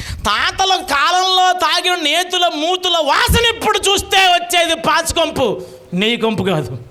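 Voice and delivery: high, slow preaching shout; ringing preacher's cry, drawn out; quoting a preacher. Retold or imitated. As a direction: boom it out like an old preacher on the pulpit. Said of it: "ఓ రోజు అంటాడు ఆయన వాక్యం చెప్తే".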